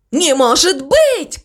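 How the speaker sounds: high, drawn-out cry of disbelief; disbelieving cry. A narrator's reading. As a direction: cry out in alarm, slowly. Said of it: "кричал он". (недоверчивый высокий возглас)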